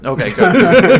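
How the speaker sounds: laughs through okay good; amused, laughing it off. Real, not acted. Host laughing at his guest's joke, amused.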